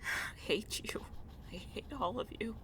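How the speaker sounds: soft, high, breath-thin voice; quiet hateful lament. Acted. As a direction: say it small and strained, to yourself. Cursing to self alone.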